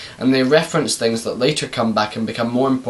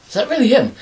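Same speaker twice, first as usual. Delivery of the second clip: surprised viewer; 9 semitones higher, faster than usual. Retold or imitated. Imagined viewer asking.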